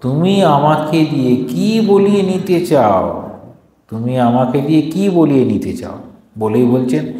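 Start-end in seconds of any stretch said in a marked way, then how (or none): 0.0-3.3 slow, higher, hurt rebuke; mother stung, pushing back slowly; offended mother. Acted. Mother rebukes the abbot, slow and offended. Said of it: "মা ক্ষুদ্র হইয়া বলিলেন".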